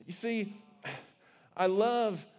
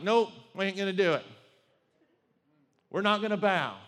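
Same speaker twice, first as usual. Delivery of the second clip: defiant refusal; 7 dB louder, faster than usual. Acted. The men refuse the king.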